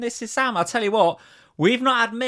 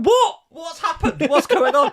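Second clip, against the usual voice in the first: high, loud confused yelps; baffled; 12 semitones higher, 4 dB louder, slower than usual. Retold or imitated. Watching on mute, friends yelp in high, loud confusion. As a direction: act totally lost, shouting at the TV. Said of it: "We're going".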